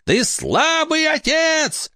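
very high, slow shrieking witch. Acted. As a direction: screech it like a furious witch. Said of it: "крикнула ведьма".

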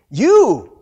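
"You" high, loud and drawn out; stress on YOU. Shown as a demonstration. Preacher drives home who Jesus meant, emphatic "You". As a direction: hit "You" hard, as one standalone word. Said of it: "Why don't we emphasize the word you?".

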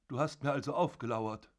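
plain reading voice

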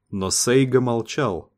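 ordinary delivery

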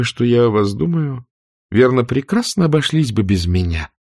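usual speaking voice

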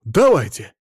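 loud, keen agreement; fired-up single word; eager. A narrator's reading. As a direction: jump at it with gusto. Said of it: "азартно сказал Мамонов".

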